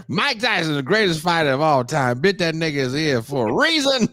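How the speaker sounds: put-on high-pitched, swooping old-man rant; old barbershop guy. Old man lecturing youngsters on boxing greats, hammed-up impression. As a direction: hold forth like a stubborn old-timer. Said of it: "Why do I sound like Bill Cosby?".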